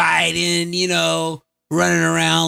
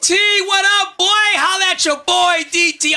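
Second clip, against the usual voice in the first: loud, high bro greeting; hollering up at the president like a buddy; hyped call-out; 10 semitones higher, 4 dB louder than usual. Pretend. Greeting Trump like a homie, loud and high.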